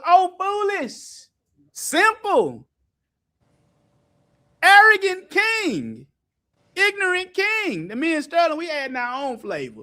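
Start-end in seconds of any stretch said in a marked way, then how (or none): 0.1-7.9 slow, high, booming prophet's rebuke; mock biblical prophet. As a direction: thunder it like an ancient prophet. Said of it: "what he called".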